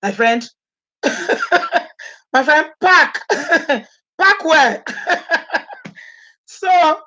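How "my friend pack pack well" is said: laughing taunt. Acted. Mocking the evicted man.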